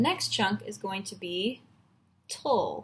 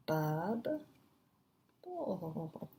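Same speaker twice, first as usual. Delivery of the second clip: soft, slow BUB, then drawn-out BOWL; low, absent-minded sounding out; murmured to oneself; 3 semitones lower, 9 dB quieter than usual. Real, not acted. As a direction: trail off quietly on the syllables. Repeats syllables softly to herself.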